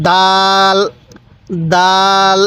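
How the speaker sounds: firm "d", then a long level vowel in দাল; hard দাল. Shown as a demonstration. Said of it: "দালটাও আমরা শক্তভাবে উচ্চারণ করব".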